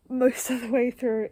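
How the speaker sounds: usual speaking voice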